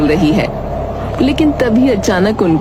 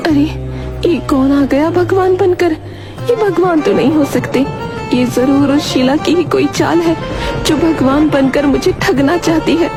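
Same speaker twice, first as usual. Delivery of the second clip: higher-pitched voice of a wary cow; suspicious cow; 9 semitones higher than usual. Acted. Cow doubting that God has really come, suspicious. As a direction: cow puzzling over a supposed trick, high-voiced. Cow character suspects a trick.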